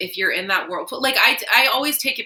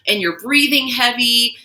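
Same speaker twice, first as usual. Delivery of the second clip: ordinary delivery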